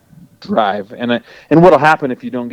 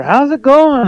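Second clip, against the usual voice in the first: imitating a chipper buddy calling; chipper friend; slower than usual. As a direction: greet like the happiest guy alive. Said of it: "he's always chipper".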